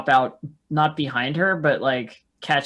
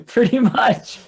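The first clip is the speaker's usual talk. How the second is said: laughing; 7 semitones higher, 4 dB louder, slower than usual. Real, not acted.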